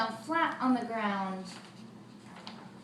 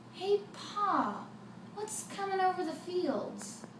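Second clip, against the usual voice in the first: put-on a much higher young boy asking; boy's voice; 8 semitones higher, slower than usual. The boy asks his father about the giant, high boy voice. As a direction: voice the son, small and curious.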